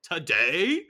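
loud, drawn-out rising disbelief; incredulous. Retold or imitated. Daughter hearing of college on waking, incredulous.

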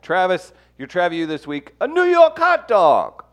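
loud, high, drawn-out announcement; game show announcer. Acted. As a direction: boom it out like a host. Announcing the segment item.

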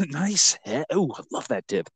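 put-on admirer's voice; mock admiring compliments; gushing compliments. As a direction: lightly act out an app user's praise. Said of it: "Non-stop compliments".

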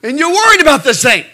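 high, loud mock rant; exasperated inner outburst. Dad silently ranting at daughter over goldfish, exasperated outburst. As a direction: burst out in comic exasperation. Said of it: "inside, I'm saying".

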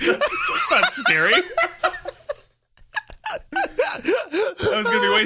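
breaking into high laughter; laughing. Real, not acted. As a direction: laugh through the words. Laughing at own slip.

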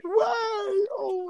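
high cracking laugh; can't hold back the giggles. Real, not acted. Bursts out laughing at the start.

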